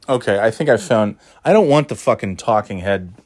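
ordinary delivery

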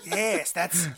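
put-on creepy character voice, amused; Peter Lorre. Podcaster mocks how the thunder god sounds, amused impression. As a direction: lightly mimic an odd film-actor voice. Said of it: "sounds like Peter Lorre".

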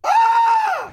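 high shrieking laugh; burst of laughter. Real, not acted. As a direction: crack up loudly. Laughing before rereading.

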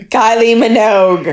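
terrible fake Australian accent; a hammy Australian accent; bad Australian accent. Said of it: "worst Australian accent anyone has ever done".

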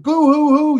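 hammed-up boo hoo sobbing; mock blubbering, high and loud; fake crying. Mocking woke employees' crying.

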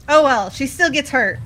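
ordinary delivery

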